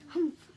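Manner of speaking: a loud scornful humph; disdainful humph. Acted. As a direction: snort it out with contempt. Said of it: "humphed a voice".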